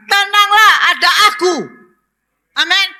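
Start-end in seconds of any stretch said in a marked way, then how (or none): 0.1-1.5 higher, louder, ringing call of comfort; God calling out. Acted. Preacher voicing God to troubled listeners, loud and high. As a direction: proclaim it over the crowd like a bold divine voice. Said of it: "Tuhan katakan".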